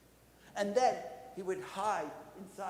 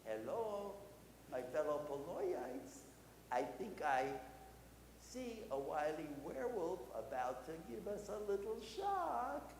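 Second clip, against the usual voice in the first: mock-innocent rabbi addressing his flock; playful rabbi; 5 dB quieter than usual. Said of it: "And then the rabbi would go".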